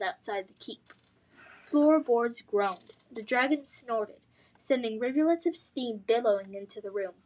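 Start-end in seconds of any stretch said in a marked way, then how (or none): none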